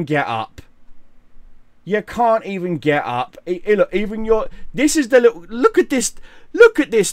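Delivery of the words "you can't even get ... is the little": usual speaking voice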